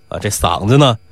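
usual speaking voice

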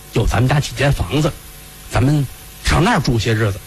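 low, weighty elder's voice; troubled household head, low and measured; worried old master. Acted. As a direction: drop the pitch and talk it over gravely. Fifth Master confides in wife.

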